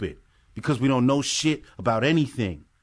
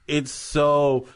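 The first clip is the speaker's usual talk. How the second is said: normal talking voice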